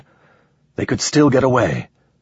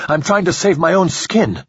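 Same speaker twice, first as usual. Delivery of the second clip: high, raging desperate plea; furious and cornered; desperate rage; 9 semitones higher than usual. Acted. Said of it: "expressing itself in rage".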